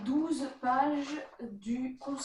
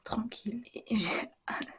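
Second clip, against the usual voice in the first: whispering; 2 semitones lower, 3 dB louder than usual. Real, not acted. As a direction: whisper it so nobody wakes. Keeps whispering at night.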